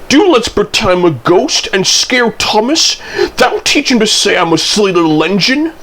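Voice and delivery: high, eager little engine hatching a prank; mischievous and gleeful, pitched well up; gleeful chuckle. A narrator's reading. Percy plots to scare Thomas, high mischievous glee. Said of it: "Percy chuckled".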